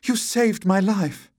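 high, drawn-out damsel gush; crying out gratefully. A narrator's reading. Damsel to her rescuer, high and slow grateful cry. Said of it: "cried the damsel".

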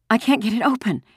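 put-on high-pitched panicky girl; frantic young girl, strained high. A narrator's reading. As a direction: blurt it in rising panic. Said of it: "Ellen said frantically".

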